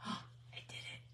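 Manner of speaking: slow awed whisper; slow whispered disbelief after success. Real, not acted. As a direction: breathe it out, amazed. Quiet triumph after success.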